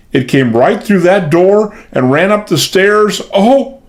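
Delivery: high, agitated frightened voice; panicked. A narrator's reading. Maid telling the men of the ghost, high panic. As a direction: near to screaming again.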